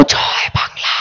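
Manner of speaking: mock the idea of a frightened, hushed slogan; a barely audible whispered জয় Bangla; mock timid whisper. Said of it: "ভেবেছিল গলার আওয়াজটা আস্তে হয়ে যাবে, আস্তে আস্তে বলবো".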